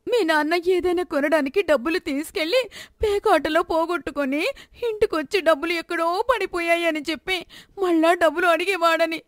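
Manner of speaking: a daughter confronting her gambling father; accusing daughter. Acted.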